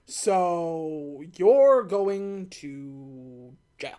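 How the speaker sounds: ordinary delivery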